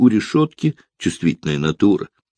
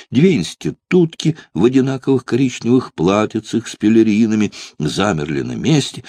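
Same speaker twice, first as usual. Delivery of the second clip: ordinary delivery, nothing marked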